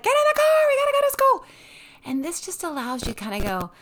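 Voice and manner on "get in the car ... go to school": high, loud mom yelling to hurry; frantic yell. Retold or imitated. Mom hurrying kids into the car, high loud yell. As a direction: shout the family out the door, high and loud. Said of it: "it's like".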